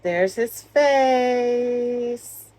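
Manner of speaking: very slow, stretched reveal; drawn out reveal. Pretend. Showing the Grinch face, a slow, stretched reveal. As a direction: drag every word out for suspense.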